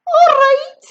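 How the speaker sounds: very high, loud "All right?" flung back; indignant yell. A narrator's reading. Grandma answering George's "all right", very high loud yell. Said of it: "she yelled".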